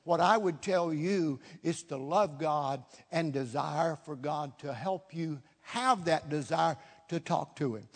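usual speaking voice

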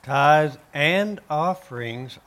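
"and" drawn out and punched between the nouns; slowly, leaning hard on the word "and"; stress on AND. Shown as a demonstration. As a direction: make "and" the key word of the phrase. Answers why "and" is emphasized.

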